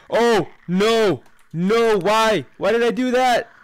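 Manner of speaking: loud, high wail of dismay; panicked regret. Real, not acted. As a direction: cry out in panic at your own mistake. Regrets own move in game.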